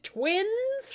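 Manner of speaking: high, loud, slow incredulous; queen shocked that her newborn speaks, loud and high; stunned disbelief. Acted. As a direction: gape at a talking baby. Queen learns of twins.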